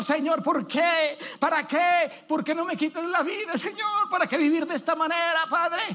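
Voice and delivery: mock tearful plea. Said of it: "Y estabas llorando".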